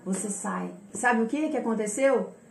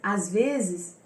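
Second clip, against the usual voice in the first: usual speaking voice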